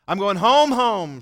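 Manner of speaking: Jane's loud insistent declaration; emphatic loud quote. Retold or imitated. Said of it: "she said".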